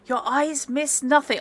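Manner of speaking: high, projected reassurance; speaking up. Acted. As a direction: speak up for a hard-of-hearing old man. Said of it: "speaking up so he can hear you".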